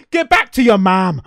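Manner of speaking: loud, high-pitched imitation of an angry retort; mock angry shout. Dan squaring up to the dog creature, mock angry shout. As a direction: belt it out as a hammed-up insult. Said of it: "they start getting angry at each other".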